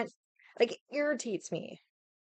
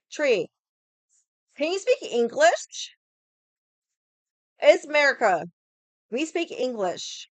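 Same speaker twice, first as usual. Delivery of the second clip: mocking annoyed customer; 5 semitones higher, 4 dB louder than usual. Angry customer mocked loudly.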